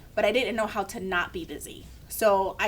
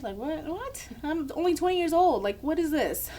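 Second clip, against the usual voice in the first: high-pitched mock disbelief; a touch of panicked incredulity; retold alarm; 7 semitones higher than usual. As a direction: protest in startled disbelief, replaying the moment. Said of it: "I was super scared".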